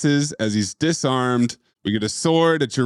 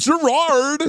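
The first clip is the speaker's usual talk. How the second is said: Chris O'Donnell; 11 semitones higher than usual. Retold or imitated. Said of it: "[name] is doing a fucking spot on".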